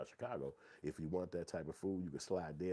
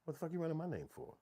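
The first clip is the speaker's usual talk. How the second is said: higher, soft indignant protest; 10 semitones higher, 3 dB quieter than usual. Retold or imitated. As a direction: push back on the cop. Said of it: "be like".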